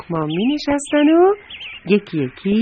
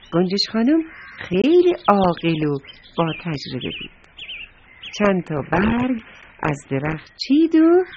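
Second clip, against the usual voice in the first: plain reading voice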